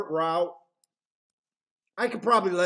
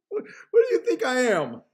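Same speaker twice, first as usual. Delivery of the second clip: laughing; 5 semitones higher than usual. Real, not acted. Laughing, mock offended.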